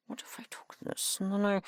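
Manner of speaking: whispered thinking aloud; muttering to oneself. Acted. Scientist puzzling over an experiment, whispered mutter. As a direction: whisper it to yourself, working it out. Muttering to self while working.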